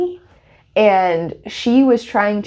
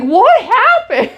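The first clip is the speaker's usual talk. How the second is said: very high, slow mock alarm; retold startled question; 4 dB louder, much slower than usual. Partner returning to the sobbing dreamer, retold startled question. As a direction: stretch it out high, imitating bewilderment. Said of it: "He's like".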